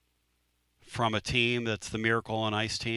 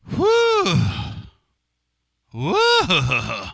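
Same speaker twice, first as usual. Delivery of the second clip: loud whew; 7 semitones higher, 7 dB louder than usual. Retold or imitated. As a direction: blow out a stunned whew. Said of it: "and I'm going".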